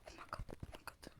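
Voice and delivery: barely audible whisper; whispered disbelief. Real, not acted. Player muttering to herself after play, whispered disbelief. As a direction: whisper it to yourself. Recovering after near miss.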